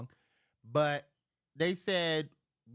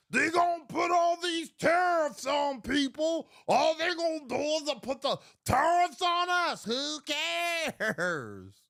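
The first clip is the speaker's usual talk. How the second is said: higher, louder ranting shout; retold shout; 10 semitones higher, 4 dB louder than usual. Arguing tariffs with uncle, retold shout. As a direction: bellow it like a rant. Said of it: "I said".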